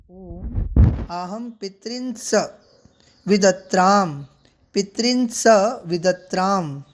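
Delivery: very slow, each word set apart with pauses. Shown as a demonstration. Teacher slowly spelling out the mantra for students, halting recitation. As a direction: break the mantra into careful pieces. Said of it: "शनै शनै संधियुक्त पदों पर ध्यान रखते हुए उच्चारित".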